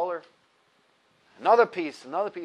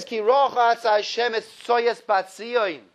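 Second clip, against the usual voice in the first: slow verse recitation; 4 semitones higher, 4 dB louder, slower than usual. Acted. Quoting the verse.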